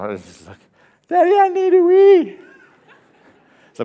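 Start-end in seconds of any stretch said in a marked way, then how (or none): 1.1-2.4 put-on high, loud little-boy plea; be a toddler who suddenly needs the loo; urgent toddler voice. Toddler announces he needs the toilet.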